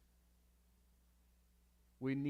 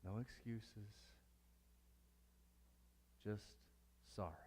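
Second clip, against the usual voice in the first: soft humble; 4 semitones lower, 13 dB quieter than usual. Real, not acted. Humble apology with no excuses.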